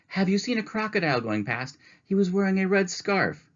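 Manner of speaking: anxious lady. Acted. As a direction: ask urgently for a lost friend. Mrs. Prim asks about crocodile.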